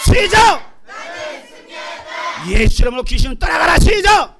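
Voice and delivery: loud, high chant leading; rallying shout. Real, not acted. Preacher cueing the congregation's chant, rallying shout. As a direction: fire up a crowd to repeat it.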